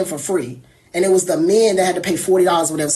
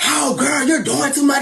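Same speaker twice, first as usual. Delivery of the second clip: mocking drunk friend; 4 semitones higher than usual. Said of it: "retorts to being told off".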